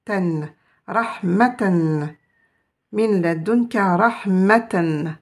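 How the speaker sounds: rahmatan drawn out slowly, ending in an n; the final -tan sounded clearly; slow tanwin ending. Shown as a demonstration. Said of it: "avec ce qu'on appelle le tanwin".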